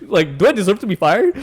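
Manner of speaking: high-pitched questioning with laughs; baffled self-defence. Retold or imitated. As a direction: plead innocence in mock disbelief. Acted worried question to manager.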